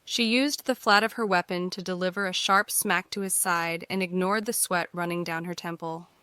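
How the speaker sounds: ordinary talk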